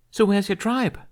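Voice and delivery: put-on high, bold girl's voice; cocky Viking girl. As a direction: brag like a tough young thief. Kamikaze questions him.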